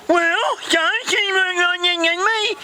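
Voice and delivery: very high, slow, garbled wail; indignant Vicky. Acted. Vicky protests to the boss, high garbled wail. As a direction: cry out in a mangled put-on voice.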